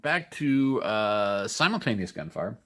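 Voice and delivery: normal talking voice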